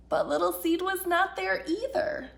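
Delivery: ordinary delivery, nothing marked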